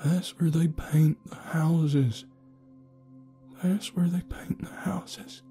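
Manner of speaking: slow, high whispered chant; haunted hushed repetition; eerie whisper. A narrator's reading. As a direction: whisper it trembling, like a warning. Trembling man repeats cryptic chant.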